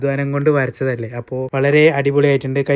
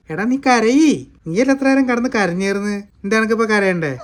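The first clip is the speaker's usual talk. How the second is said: high, raised-voice needling; teasing goading; 6 semitones higher, 5 dB louder than usual. Real, not acted. Father teasingly goading baby to cry. (കളിയാക്കി, ശബ്ദമുയർത്തി കുത്തിച്ചോദിക്കുന്നു)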